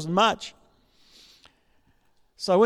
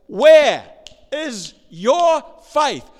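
loud high where, slow is your faith; big shout on where, then slow; booming where; 7 semitones higher, 9 dB louder, much slower than usual. Acted. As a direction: boom it out like a rebuke. Quoting Jesus at full force.

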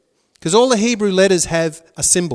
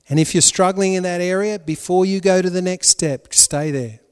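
ordinary talk

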